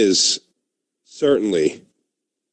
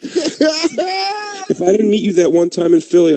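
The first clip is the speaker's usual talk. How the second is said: high, slow hammed-up crying; mock tearful; 9 semitones higher, slower than usual. Friend toasting Keith, exaggerated tearful breakdown. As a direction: wail it out as fake sobbing. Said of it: "ooh, this is hard".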